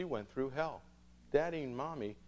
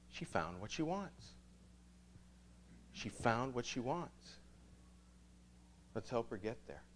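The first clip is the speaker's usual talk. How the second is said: quiet gratitude; 7 dB quieter than usual. Retold or imitated. Quiet reflective aside to saint.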